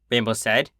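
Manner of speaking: usual speaking voice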